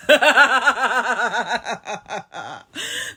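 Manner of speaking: long rising witchy cackle; witch laugh. Real, not acted. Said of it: "they say I have a witch laugh".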